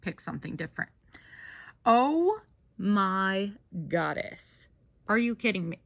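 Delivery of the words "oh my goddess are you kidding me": exasperated. Real, not acted. List glitch again.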